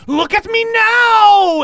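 very high, loud, drawn-out scream. A narrator's reading. Game master voices her severed-head moment, screaming. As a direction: scream it slowly, stretching it out triumphantly. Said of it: "she screams".

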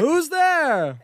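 high-pitched crowd response; high audience call. Acted. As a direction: squeal it back like a crowd.

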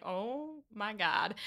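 a hint of a dismayed old man, slow. Host voicing Mister Woodhouse, slowly dismayed at the snow.

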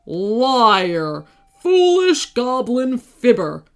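loud spitting dragon fury; spat out. Acted. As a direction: spit the words out loud. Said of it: "spat the dragon".

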